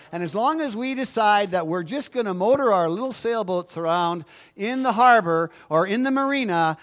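normal talking voice

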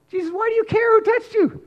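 Peter incredulous. Acted. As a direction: squeak out the disbelief. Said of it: "what Peter's saying is".

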